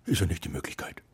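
stunned whisper at the fairy's reveal; astonished whisper. Acted. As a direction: whisper in disbelief.

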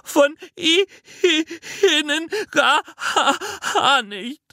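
put-on high, hiccupping child wail; sobbing. A narrator's reading. Jana saying the inside is all wrong, sobbing. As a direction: sob so hard the words split. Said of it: "schluchzte Jana".

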